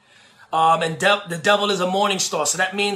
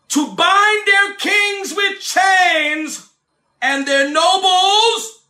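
preaching scripture; 6 semitones higher, 3 dB louder than usual. Acted. Preacher reading Psalm 149 aloud to his congregation, proclaiming. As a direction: declaim the psalm, stretching out kings and chains.

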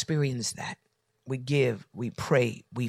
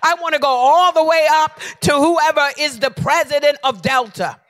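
loud, high furious yelling; angry shouting; 12 semitones higher, 8 dB louder than usual. Retold or imitated. Pastor on the phone with Delta, shouting angrily. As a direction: demand the supervisor at full voice. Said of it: "I am angry".

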